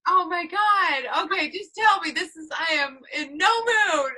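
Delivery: mock exasperated, laughing through it; laughing exasperation. Real, not acted. Stuck on a name guess, laughing exasperated.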